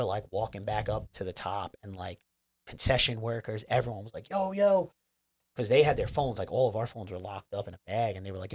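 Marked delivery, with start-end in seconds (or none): 4.3-4.9 high-pitched eager hail; excited greeting call. Retold or imitated. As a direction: imitate workers calling out in recognition. Imitates concession workers calling to him.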